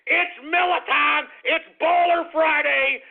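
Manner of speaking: high, loud party cry; hollered slogan. Real, not acted. Hollering his Friday slogan.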